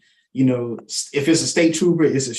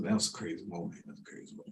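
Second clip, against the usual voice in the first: softer, fading to a whisper; hushed disbelief; 7 semitones lower, 18 dB quieter, faster than usual. Real, not acted. Remembering the traffic stop, hushed whisper. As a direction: let it drain away in awe.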